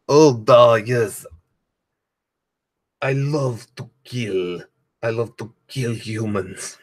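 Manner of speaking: hammed-up Russian accent, slow and murderous; a comedian's cheesy Russian accent, relishing the killing. Comedian voicing his D&D murderess in a hammed-up Russian accent. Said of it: "like, a Russian accent".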